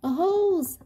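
very high-pitched surprised question; astonished question. A narrator's reading. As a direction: echo it in high disbelief. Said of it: "asked Dragonfly".

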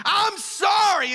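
high, loud, slow yelled apology; angry fake apology. Daughter yells sorry at her mom, angry shout. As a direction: shout the apology like you don't mean it. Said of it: "she's like".

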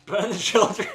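high, loud hammed-up yell; playful evil shout; mock villain cry. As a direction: roar it as a game baddie.

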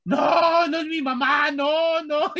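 very high mock shout of no; mock child yelling. Child screaming that she's not his mother, very high mock yell. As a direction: scream like a frantic little kid. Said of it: "pero yo como loco".

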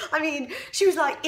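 ordinary talk

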